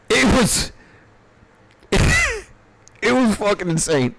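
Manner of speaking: high, slow words through real laughter; laughing. Real, not acted. Teller cracks up recalling the night, laughing through the words. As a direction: laugh it out, barely getting the words.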